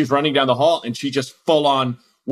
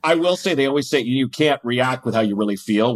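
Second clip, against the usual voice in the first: ordinary talk, nothing marked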